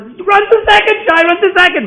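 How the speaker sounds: very high, full-force holler; excited cheering yell. Retold or imitated. Boys send Shai on to second, excited yelling. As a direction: scream the runner onward. Acted cheering at first base.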